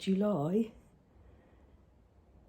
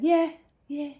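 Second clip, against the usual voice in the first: put-on child voice; 6 semitones higher, 6 dB louder than usual. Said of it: "And he went".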